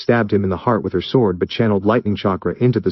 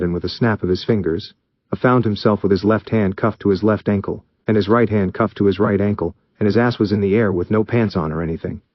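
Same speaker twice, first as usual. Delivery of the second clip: ordinary delivery, nothing marked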